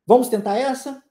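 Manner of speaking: usual speaking voice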